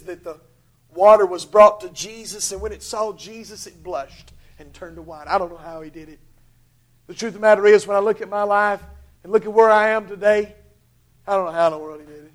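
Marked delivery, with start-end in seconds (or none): none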